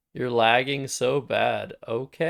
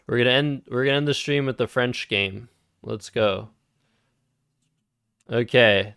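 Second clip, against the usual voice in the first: normal talking voice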